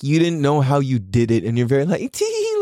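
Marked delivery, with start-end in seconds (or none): 2.1-2.6 fake modest squeak. Mocking humble cook.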